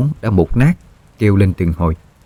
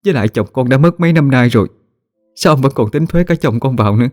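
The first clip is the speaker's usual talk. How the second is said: higher, fast desperate begging; desperate plea; 6 semitones higher than usual. Acted. Kneeling widow begs the chief not to tax her dead husband, high and urgent. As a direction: beg on your knees, rushing. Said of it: "mà giọng cầu xin".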